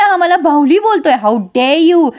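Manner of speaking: acted affront; mock outrage. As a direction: tattle indignantly, lightly hammed-up. Insulted daughter complains, retold.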